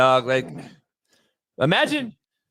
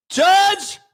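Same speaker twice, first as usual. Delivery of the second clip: higher, louder, slow pleading yell; mock desperate shout; 10 semitones higher, 5 dB louder, much slower than usual. Defendant shouting to the judge to convict him. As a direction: beg to be locked up. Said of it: "begging the judge in mock despair".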